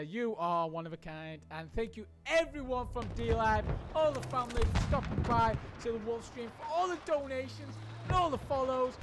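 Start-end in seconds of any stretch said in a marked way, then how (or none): none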